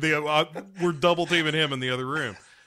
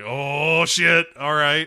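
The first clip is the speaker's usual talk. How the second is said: loud, higher startled realization; mock alarm; 4 semitones higher, 9 dB louder, slower than usual. Atticus realizing the stakes, loud mock alarm. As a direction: blurt it out, caught off guard. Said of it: "It's like".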